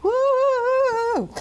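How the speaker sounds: high whoop of cheer; excited whoop. Pretend. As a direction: let out a happy high whoop. Cheering for backup dancers.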